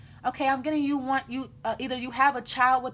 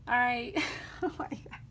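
real laughter, voice dropping away; laughing; 9 dB quieter than usual. Real, not acted. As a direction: laugh helplessly through the words. She cracks up at the reading.

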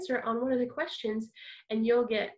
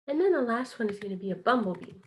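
normal talking voice

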